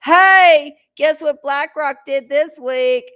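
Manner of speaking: loud, high mock yell; yelling in your ear. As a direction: shout it right into their ear, slowly. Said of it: "not whispering, but yelling".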